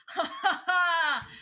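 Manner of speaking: slow, high, loud mock laugh; mocking villain laugh. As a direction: cackle at her, drawn out and loud. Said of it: "And Edris is like".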